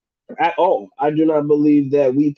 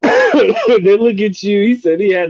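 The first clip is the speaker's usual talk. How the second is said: laughing; 5 semitones higher, 4 dB louder, slower than usual. Real, not acted. Laughing at a viewer.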